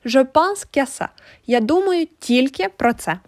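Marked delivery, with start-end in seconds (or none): none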